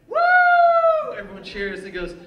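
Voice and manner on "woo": very high, loud mock cheer whoop; imitated crowd cheer.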